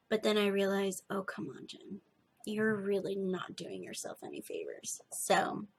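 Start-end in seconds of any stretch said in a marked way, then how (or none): none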